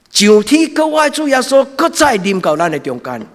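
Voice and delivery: loud, high, impassioned sermon; fiery preaching. Acted. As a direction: preach it out at full force. Preaching at full force.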